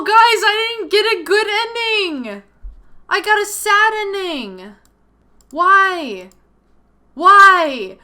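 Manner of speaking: high, loud, slow whining protest; dismayed wail. Real, not acted. Player wailing to viewers about the sad ending, loud and high. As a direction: wail at the game like it betrayed you.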